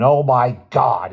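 slow horrified exclamation; stunned, slowed-down oh my God; shocked gasp. Acted. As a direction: drag it out in shock. Shocked at the door.

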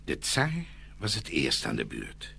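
plain reading voice

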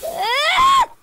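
high, loud shriek; sudden rising scream; startled scream. Real, not acted. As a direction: scream in fright.

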